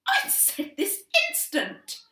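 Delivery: slow, loud, high furious mum; final warning, fully enraged; furious command. A narrator's reading. Mother orders louder.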